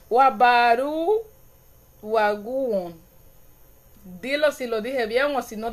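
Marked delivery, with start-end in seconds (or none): 0.1-3.0 slow retry. Shown as a demonstration. Streamer retrying a Garífuna word for her viewers. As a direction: try the Garífuna word again, stretching it slowly. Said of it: "vamos a intentarlo de nuevo".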